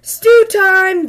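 high, loud call to dinner. A narrator's reading. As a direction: call the cubs over, bright and loud. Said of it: "When Papa cried".

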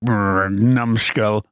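a low slow mock mumble; mumbled insult. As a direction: mutter it under your breath. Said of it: "mumbling something under his breath".

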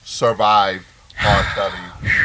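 survived R. Kelly said slow, in finger quotes; slow sarcastic survived R. Kelly; air quotes. Shown as a demonstration. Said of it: "I'm doing finger quotes".

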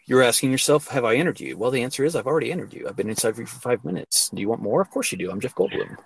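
low, softened self-questioning bedroom talk; mock seduction as a musing monologue; Jeff Goldblum. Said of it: "talks like that in bed".

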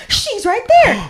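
can't contain himself; excited pointing out. Retold or imitated. Fan pointing out his friend excitedly.

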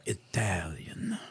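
breathy whispered Italian. Acted. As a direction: whisper it like a secret.

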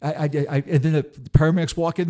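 usual speaking voice

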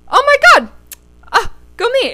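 high, loud burst of surprise; sudden delighted realization. Real, not acted. Host realizing it was a year ago, excited surprise. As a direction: exclaim it as it dawns on you, pitch leaping up. Realizes the anniversary mid-story.

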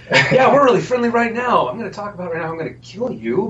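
cheerful menace like a mobster; sing-song British mobster. Retold or imitated. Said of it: "start the sing-song happy".

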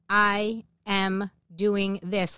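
very slow, word by word; retold resolution with weighty pauses; determined vow. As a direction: spell out your resolve, one word at a time.

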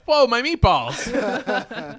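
slow, high, loud mock dismay with laughter; bear voice. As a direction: play the bear upset.

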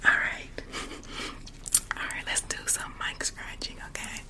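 hushed ASMR whisper; voiceless, very quiet whisper. Real, not acted. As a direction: whisper softly to the listeners, close and intimate. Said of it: "do you want me to keep whispering".

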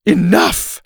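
high, loud one-word rebuke; scolding. A narrator's reading. Teacher silencing the groaning class, loud scolding. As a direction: cut them off with a loud scold. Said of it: "Missus Arthur scolded".

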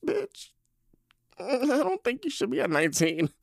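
high, laughing through the words. Real, not acted. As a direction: talk while still laughing in disbelief. Laughing disbelief at friend's level.

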